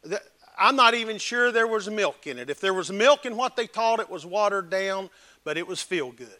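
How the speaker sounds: ordinary delivery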